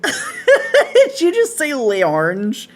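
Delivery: high, slow laughing disbelief. Real, not acted. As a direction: laugh through the question. Laughing at a funny pronunciation.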